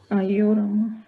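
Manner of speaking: soft exasperated groan of despair; ayyo wail. Real, not acted. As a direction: sigh it out, fed up with silence. No student answers the teacher.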